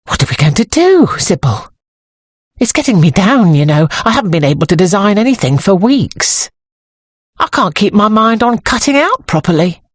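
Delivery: mildly panicky, pleading for help; hint of panic. A narrator's reading. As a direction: let a little panic lift the voice. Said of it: "added with a hint of panic".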